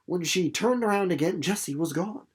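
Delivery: normal talking voice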